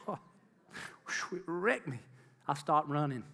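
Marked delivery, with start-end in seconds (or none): none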